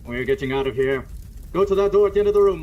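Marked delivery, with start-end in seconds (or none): 1.5-2.6 fast, loud, high orders; urgent shout. Acted. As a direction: hurry her through the flames. Escaping the fire.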